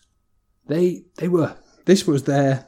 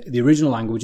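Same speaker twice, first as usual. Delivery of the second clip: normal talking voice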